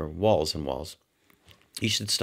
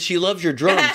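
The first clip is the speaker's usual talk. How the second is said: louder, higher, laughing cover-up; raised voice; 9 semitones higher, 6 dB louder than usual. Retold or imitated. Shouting to Roger to cover the slip, laughing. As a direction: say it loud for a deaf listener.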